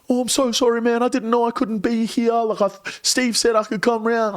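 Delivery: high, meek mock apology; a timid little voice, lightly put on; scared stranger apologizing, high and meek. Scared small guy apologizing.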